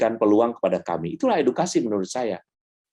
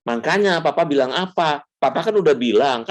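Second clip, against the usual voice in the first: nagging parent; 6 semitones higher, 4 dB louder than usual. Retold or imitated. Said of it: "orang tua kan biasanya kan gini".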